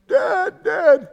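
put-on high, pleading child's call of Dad; daughter calling out. Imitating his small daughter calling from the closet. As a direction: beg to be let out like a little girl.

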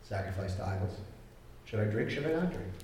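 soft, hushed wondering to himself; musing quietly. Retold or imitated. Believer quietly asking the Lord, hushed musing. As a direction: quietly weigh it out in prayer. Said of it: "and you go".

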